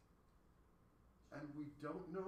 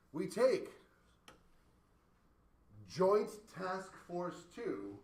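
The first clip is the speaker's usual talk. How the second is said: slow emphatic build-up; 3 semitones higher, 7 dB louder, much slower than usual. Acted. Building up the analogy.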